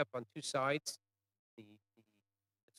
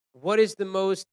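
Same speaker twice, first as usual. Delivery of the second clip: loud, higher retold demand; raised interrogator's voice; Nazis calling out; 6 semitones higher, 9 dB louder than usual. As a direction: call out the question forcefully. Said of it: "the Nazis call out".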